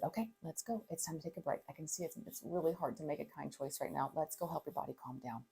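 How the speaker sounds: quiet, gentle guiding voice; soft calm parent. Retold or imitated. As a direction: lead the upset child away softly. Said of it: "And so I said".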